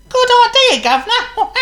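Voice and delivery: very high, loud, laughing monkey voice; chirpy monkey agreeing with a giggle; squeaky cheeky monkey; Chatter the monkey. Acted.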